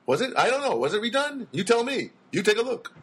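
needling the listener in a put-on comedian voice; Jackie Mason impression. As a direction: do a Borscht Belt comic's shrugging patter. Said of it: "Now I'm doing Jackie Mason".

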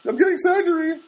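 very high, loud blurting; hammed-up excitement; mock excited announcing. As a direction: blurt out the big news in a hammed-up squeal. Acts out telling everybody the news.

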